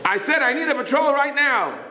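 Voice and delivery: high-pitched, exasperated demand; insistent demand. Retold or imitated. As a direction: insist over the radio, voice climbing. Patrolman insists on the radio.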